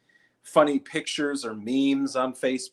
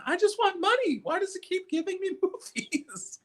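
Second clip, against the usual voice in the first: put-on high, whiny confused old lady; hammed-up high old lady voice; mock old lady. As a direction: plead like a lost grandma at a machine. Confused old lady at red box.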